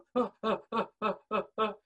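string of short, even wordless sobs; trua crying sound. Shown as a demonstration. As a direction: imitate broken shofar sobbing in quick pulses. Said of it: "That's the trua".

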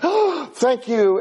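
big gasp, high drawn-out thanks; slow, high-pitched acted relief; relieved gratitude. Thirsty man to nurse bringing Coke, hugely grateful.

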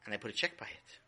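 plain reading voice

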